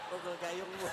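bisikan geli, jauh lebih pelan; sambil tertawa. Real, not acted. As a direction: celetukan sambil menahan tawa. Joking aside to audience. (ustaz bercanda ke jamaah sambil tertawa pelan)

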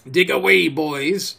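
loud, breezy mockery; cheeky, jeering pirate call; cool insolence. A narrator's reading. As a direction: toss it out insolently to the crew. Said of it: "with the coolest insolence".

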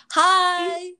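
loud, high hi; excited greeting. Real, not acted. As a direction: call out hi with energy. Podcast intro greeting.

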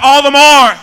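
full-volume rallying cry; high, loud pulpit shout. Shown as a demonstration. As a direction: belt it out for the congregation.

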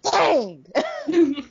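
loud, high, shocked dang; shouted dang. Pretend. Friend echoing the joke, shouting in mock shock. Repeating exclamation louder.

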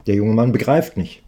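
ordinary delivery

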